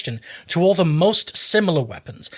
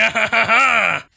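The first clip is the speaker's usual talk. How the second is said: amused laugh; 6 semitones higher, 3 dB louder than usual. Real, not acted. Laughs at own silly accents.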